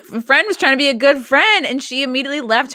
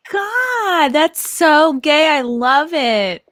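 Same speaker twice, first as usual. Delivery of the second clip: gushing; much slower than usual. Real, not acted. Gushing over wedding story.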